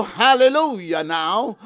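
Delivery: usual speaking voice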